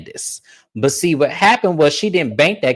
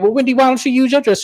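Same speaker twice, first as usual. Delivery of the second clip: high, quick mock voice; high fast voice; 11 semitones higher, faster than usual. Voicing Wendy, quick.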